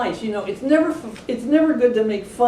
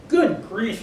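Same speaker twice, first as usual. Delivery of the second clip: grumbling lion. A narrator's reading. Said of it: "he grumbled".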